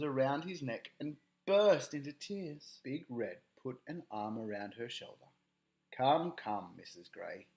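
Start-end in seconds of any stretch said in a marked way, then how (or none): 5.9-7.5 kindly. A narrator's reading. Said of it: "he said kindly".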